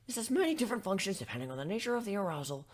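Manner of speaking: usual speaking voice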